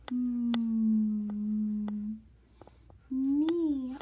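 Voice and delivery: Me stretched out loud and slow, the sound in meow. Shown as a demonstration. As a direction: sound out Me clearly. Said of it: "like the word me".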